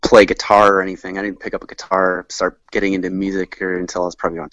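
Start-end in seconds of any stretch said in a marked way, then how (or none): none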